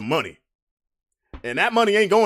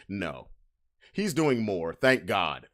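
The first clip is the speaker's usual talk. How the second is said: ordinary talk, nothing marked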